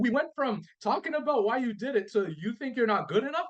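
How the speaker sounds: can't believe where this went; incredulous. Real, not acted. Husband tells the host his disbelief, voice pitched high.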